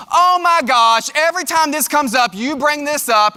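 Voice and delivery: high, drawn-out, loud complaint; exasperated reaction. Acted. Partner snapping back when asked to talk, exasperated. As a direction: blow up in mock exasperation. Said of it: "you start having that type of reaction".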